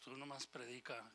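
God repeating the order to Ezekiel, hushed; hushed command. Acted. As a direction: drop to a near hush.

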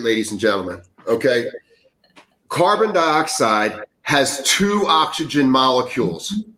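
usual speaking voice

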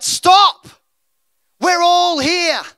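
high shouted cry, slowing on the last words; scream. Acted. Paul screaming out to the jailer to stop, shouting. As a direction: yell it across the prison. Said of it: "He screams out".

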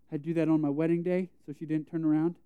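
normal talking voice

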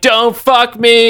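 high, slow damsel plea; mock damsel Logan. Logan tied up, slow high mock damsel plea. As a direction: stretch it out like a swooning damsel. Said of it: "and he's like".

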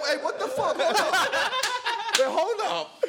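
high, laughing disbelief; mock disbelief. Realizing the welcome wasn't special, high laughing disbelief. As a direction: catch on halfway through laughing. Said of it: "I said".

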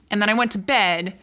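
normal talking voice

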